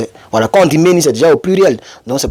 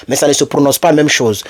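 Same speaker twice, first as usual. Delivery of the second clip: usual speaking voice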